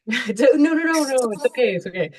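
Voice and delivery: high laughing no no no; laughing reassurance. Real, not acted. Laughing off an apology.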